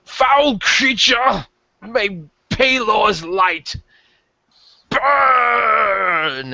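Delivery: high, slow, shouted holy proclamation; hammy cleric. Acted. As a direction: declaim it like a zealous priest. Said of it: "hamming it up".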